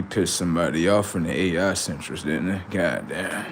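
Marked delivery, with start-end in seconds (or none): none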